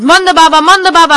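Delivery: high, loud calling out; yelling. Acted. Car passengers calling to Manda Baba, yelling. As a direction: yell his name to get his attention. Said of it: "and they yelled".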